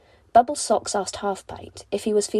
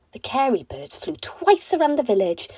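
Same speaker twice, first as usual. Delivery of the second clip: ordinary delivery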